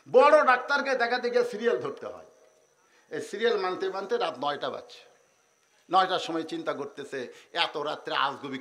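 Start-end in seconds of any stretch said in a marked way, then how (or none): none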